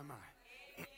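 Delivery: normal talking voice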